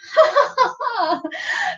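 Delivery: high, put-on forced laugh; fake laugh. Shown as a demonstration. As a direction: start a laugh on purpose to get everyone going. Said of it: "is I go".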